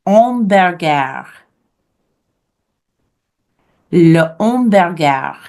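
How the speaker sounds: French "hamburger" without the H, om-bair-gair; silent H. Shown as a demonstration. Teacher shows hamburger in French, silent H. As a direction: drop the H in "hamburger", slow French vowels. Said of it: "we don't pronounce H's".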